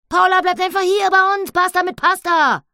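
very high, loud piglet voice; a pleased piglet settling it. Acted. Annabella proposes. (Annabella, sehr hoch und laut)

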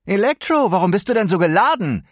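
loud quick heroic quip; calling out. Acted. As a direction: shout a joke across the tunnel. Hero calls out to villain.

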